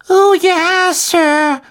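squeaky high elf voice, earnest; a tiny servant elf, very serious; earnest house-elf. A narrator's reading. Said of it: "sagte Dobby mit ernster Miene".

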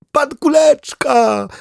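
very high, loud, slow joyful greeting; joyful chorus call. Acted. Duck and dog greeting their friend at the door, joyful loud call. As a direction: cheer the name of the returning friend. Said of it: "zawołali chórem".

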